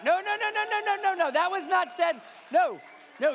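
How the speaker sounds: high, loud string of no's; mock-panicked denial; loud high protest. Real, not acted. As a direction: cut off the crowd's reaction, loud and high. Reacting to imagined audience groans.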